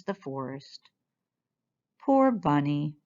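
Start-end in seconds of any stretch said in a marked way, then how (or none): none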